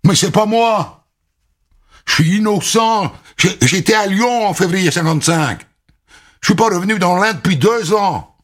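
high pleading cry of innocence; desperate protest. Acted. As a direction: beg them to believe you. Accused protests innocence, voiced.

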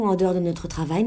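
ordinary delivery

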